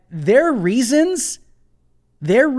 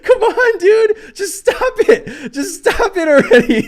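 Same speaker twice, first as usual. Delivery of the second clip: real laughing fit, pitched way up, words squeezed out between laughs; can't stop cracking up at the article; helpless laughter; 13 semitones higher, 3 dB louder than usual. Real, not acted. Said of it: "Sorry about that".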